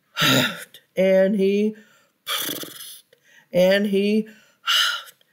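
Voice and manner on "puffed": breathy blowing puff, almost no voice; puffed breath. Shown as a demonstration. Wolf blowing hard at the brick house, a breathy puff. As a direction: blow the word out like a gust. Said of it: "narrator blows like the wolf".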